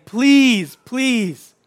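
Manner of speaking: loud, high, drawn-out plea; pleading. Acted. Moses pleads with his people, urgent and imploring. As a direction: beg them, stretching each word slowly and forcefully. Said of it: "he's just pleading with them".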